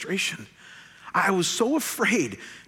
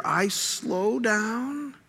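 very slow, stretched-out words, higher pitch; slowed right down; 6 semitones higher, much slower than usual. Shown as a demonstration. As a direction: draw out each word to show slowing down. Acts out slowing down.

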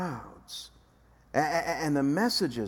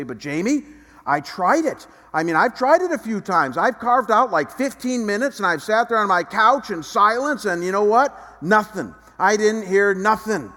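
louder, higher whiny complaint, a mock grumbling churchgoer; complaining congregant; 5 semitones higher, 7 dB louder than usual. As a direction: gripe that nothing happened, raised and pitchy. Congregant complaining in imagined dialogue.

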